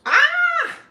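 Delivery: very high, loud delighted shriek; sudden piercing happy scream; excited squeal. Real, not acted. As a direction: squeal as a friend shows up. Squeals at a friend joining.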